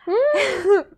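a loud burst of laughter; laughing out loud. Real, not acted. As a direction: laugh loudly. Laughs at scene.

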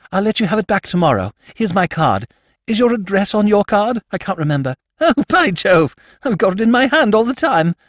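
put-on high, fast, gushing young toff. Young lord babbling thanks to Jimmy, high fast gushing voice. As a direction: rattle it off high like an excited young aristocrat.

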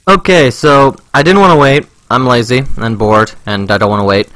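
ordinary talk

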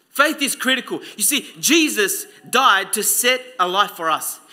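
ordinary talk, nothing marked

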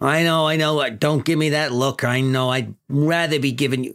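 lightly voiced New York boss, apologetic; mildly defensive precinct chief; police chief. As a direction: fend off the detectives' complaints.

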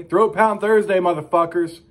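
loud, high, hyped opening announcement; Throat Pound Thursday punched out; show opener shout. Acted. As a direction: sell the show title like a host.

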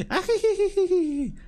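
high, slow witchy cackle; evil cackle. Acted. Monster cackling at its victims, high evil cackle. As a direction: cackle like a crazed creature.

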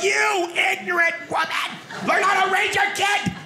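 very high-pitched comic tirade; mock angry shouting. Moviegoer fighting kid's mother, high mock-angry yelling. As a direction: yell it shrilly in exaggerated outrage. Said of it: "I'm like".

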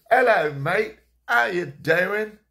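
put-on high, loud London accent, very slow; a cheery London granddad, stretched out in a London accent; grandfather greeting me. Grandfather greeting his grandson at the door, slow high London accent. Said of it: "he'd go".

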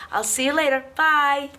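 ordinary talk, nothing marked

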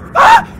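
sudden high, loud shriek; startled scream. Real, not acted. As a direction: yelp in shock. Startled by monster in game.

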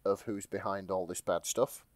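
ordinary talk

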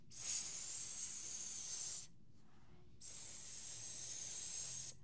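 long whispered sss; snake hiss. Shown as a demonstration. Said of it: "La serpiente hace".

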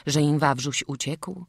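ordinary delivery

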